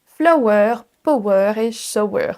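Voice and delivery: flower, power, shower with a French o; slow learner's flower, power, shower; French O mistake. Shown as a demonstration. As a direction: say flower as flo-wer, likewise power, shower. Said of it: "J'avais tendance à dire".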